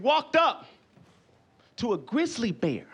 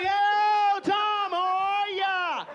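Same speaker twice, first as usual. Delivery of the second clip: high, slow, stretched-out hail; imitated bear man greeting; 11 semitones higher, much slower than usual. Bear man hails Tom the bear, high drawn-out call. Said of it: "He's like".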